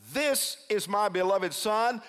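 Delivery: measured, high-pitched announcement from heaven; booming heavenly voice. Acted. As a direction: declare it slowly from on high. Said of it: "that same voice comes booming".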